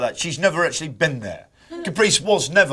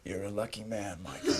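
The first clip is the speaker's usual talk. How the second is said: Jack Nicholson impression; 10 semitones lower, 17 dB quieter than usual. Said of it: "You imagine sitting back there going".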